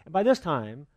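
usual speaking voice

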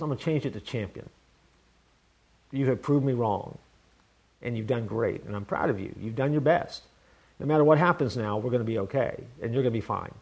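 quietly telling his son he's proud; tender proud father. Retold or imitated. Father to his grown son, soft and tender.

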